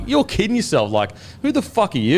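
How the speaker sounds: high-pitched mock incredulity; laughing dismissal. Barrow brushing off the sergeant as a prank, retold scoffing disbelief. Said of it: "like, laughing".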